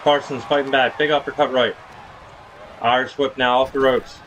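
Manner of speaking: punchy ringside commentary; wrestling play-by-play. Acted. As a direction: call each wrestling move as it lands. Calls wrestling match play-by-play.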